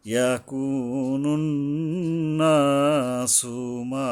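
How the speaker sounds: slow recitation of ইয়াকুনুন নাসু মা, humming the nun; gunnah and elongation. Shown as a demonstration. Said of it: "নুনের উপরে গুণনা করতে হবে". (ইয়াকুনুন নাসু মা গুন্নাহ ও টান দিয়ে পড়া)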